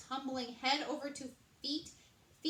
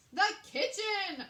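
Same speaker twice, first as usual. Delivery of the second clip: much louder, higher, slow shout; 10 semitones higher, 10 dB louder, slower than usual. A narrator's reading. Chef shouting to the guards about the fleeing rat. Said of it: "Andre shouted".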